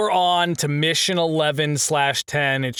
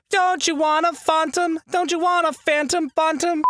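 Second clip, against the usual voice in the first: high, posh-styled British voice saying Phantom; British accent; 11 semitones higher than usual. Pretend. Narrating about a dropship, jokingly switching to British accent. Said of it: "doing the British accent thing".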